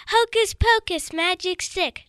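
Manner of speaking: high screamed incantation; screamed spell. Acted. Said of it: "screamed into it".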